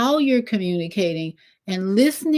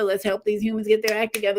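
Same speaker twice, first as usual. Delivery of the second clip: fast aside; 3 dB quieter, much faster than usual. Pretend. The moon urges, second take.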